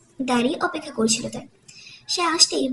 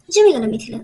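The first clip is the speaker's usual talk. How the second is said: ordinary delivery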